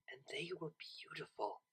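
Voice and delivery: hushed whisper of wonder. A narrator's reading. Narrator whispers as Octavia gazes at Vinyl's eyes. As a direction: breathe it out in awe.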